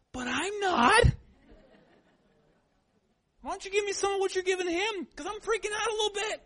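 high, loud, rushed pleading; mock panic. Dad begs the nurse for some anesthesia too, in hammed-up panic. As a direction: play up a dad losing it over his son. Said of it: "And I'm like".

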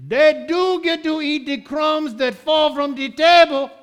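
put-on triumphant Caribbean accent. Caribbean lady winning the argument with Jesus, loud Caribbean accent. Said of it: "But my Caribbean lady says".

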